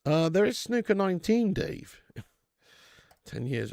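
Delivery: ordinary talk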